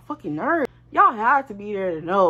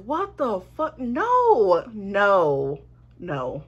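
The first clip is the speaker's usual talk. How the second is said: shocked refusal; much slower than usual. Retold or imitated. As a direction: recoil at the request in disbelief. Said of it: "I'm like".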